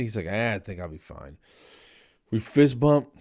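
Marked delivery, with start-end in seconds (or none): 0.3-1.4 lightly voiced ailing pet, low and soft; a touch of brave invalid; weary patient. Voices the bandaged guinea pig.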